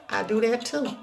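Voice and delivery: ordinary talk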